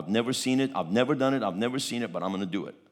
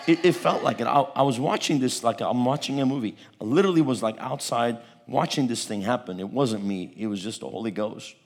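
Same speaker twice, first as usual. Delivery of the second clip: ordinary talk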